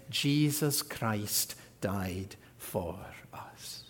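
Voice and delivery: slow, breathed whisper after the climax; hushed whisper. Real, not acted. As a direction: drop to a whisper and let it land. Whispered climax after building list.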